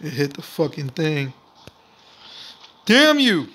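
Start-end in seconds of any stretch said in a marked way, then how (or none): 2.8-3.5 loud, high frustrated yell; angry shout. Real, not acted. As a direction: curse the game out loud. Angry at game.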